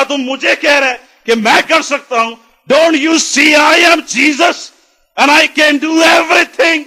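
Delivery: indignant shout, high and loud; slow the English into a ringing, offended roar; holy anger. Acted. Preacher as Jesus rebuking the doubting father, holy anger. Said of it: "Jesus is having holy anger inside".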